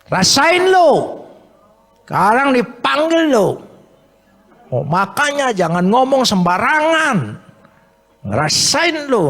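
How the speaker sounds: high, taunting "serves you right"; mocking jeer. Crowd jeering at the blind beggar, high and mocking. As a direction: sneer it like a gloating crowd.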